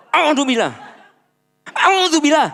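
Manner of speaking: exaggerated frustrated repeats; mock road-rage ta'awuz. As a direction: blurt it out like an angry driver.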